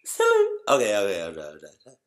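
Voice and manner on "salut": extremely high squeak of Salut. Shown as a demonstration.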